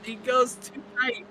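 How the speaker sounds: high-pitched, rising live commentary; excited race call. Real, not acted. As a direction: call the near miss as it happens, voice jumping up high. Live call of a tight overtake.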